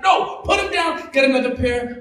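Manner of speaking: high, sharp maternal refusal; scolding mom. Retold or imitated. Mom refusing her son's glasses, high scolding shout. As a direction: shut the kid down firmly.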